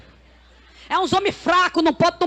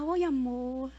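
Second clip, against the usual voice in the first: very soft, low, tender put-on voice; sweet wifely greeting; 3 semitones lower, 11 dB quieter, much slower than usual. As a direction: coo it softly, as a husband wants to hear. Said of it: "meu marido diz que eu tenho que falar". (voz mansa e carinhosa de esposa, encenada)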